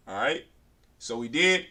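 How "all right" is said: normal talking voice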